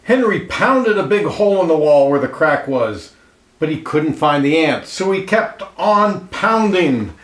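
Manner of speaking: lively read-aloud storyteller voice, clear and even; storybook narration. A narrator's reading. Reading a picture book aloud to children, lively storyteller voice. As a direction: tell it like a picture book for kids.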